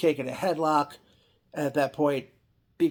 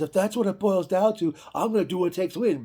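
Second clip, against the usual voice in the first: cocky wrestler; 5 semitones higher than usual. Retold or imitated. Rude's cocky reply quoted.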